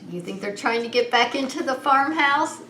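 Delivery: usual speaking voice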